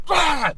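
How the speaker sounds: sudden high loud mock sneeze, ah; imitated sneeze. Retelling Sam sneezing straight into the camera, a mock sneeze. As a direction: throw out a big fake sneeze.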